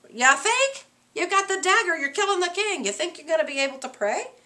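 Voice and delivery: mock his silly worry; sarcastic scolding. Teacher voices mocking rebuke.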